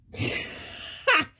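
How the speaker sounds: unvoiced burst of laughter; breathy laugh. Real, not acted. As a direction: let a real laugh slip out. Laughs at her own words.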